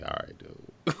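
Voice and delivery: put-on low, breathy drawled southern accent. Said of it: "his accent was kinda like".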